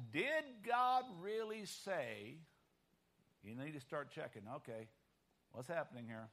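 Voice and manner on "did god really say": slow, drawn-out questioning; doubting question. Retold or imitated. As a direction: stretch out the question to sow doubt. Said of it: "The minute they ask".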